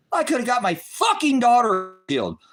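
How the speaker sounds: screaming the guilt at the top of his voice; anguished shout. Real, not acted. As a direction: cry it out high and loud, voice cracking with grief. Anguished repeat of same line.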